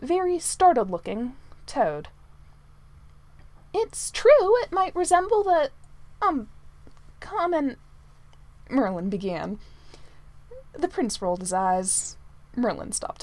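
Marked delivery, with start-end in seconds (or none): 3.7-7.8 high, louder hedging voice; flustered servant. A narrator's reading. Manservant excusing his toad to the prince, flustered. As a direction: hesitate and grope for the word.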